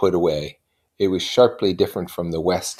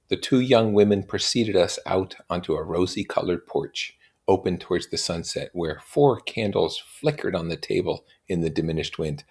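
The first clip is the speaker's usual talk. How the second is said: normal talking voice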